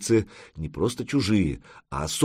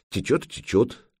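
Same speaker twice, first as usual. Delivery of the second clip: ordinary delivery, nothing marked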